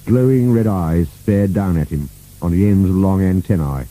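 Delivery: ordinary delivery, nothing marked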